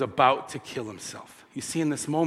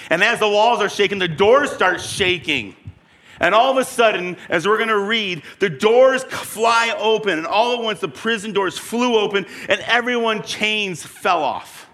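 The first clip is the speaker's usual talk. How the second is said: louder and higher, rising to a shout; building excitement; 7 semitones higher, 4 dB louder than usual. A narrator's reading. Preacher telling the earthquake, excited rising shout. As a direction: build the quake to a cry.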